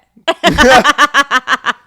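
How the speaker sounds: high, loud laughing fit; loud laughter. Real, not acted. Joking about old lies to partners, real loud laughter. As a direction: crack up at your own confession. Laughing at her own confession.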